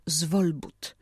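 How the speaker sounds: zwolbut stressed on ZWOL, the next-to-last syllable; penultimate stress. Shown as a demonstration. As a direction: say zwolbut slowly, leaning on the first syllable. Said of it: "Większość skrótowców ma taki akcent". (zwolbut z akcentem na przedostatnią sylabę: ZWOL-but)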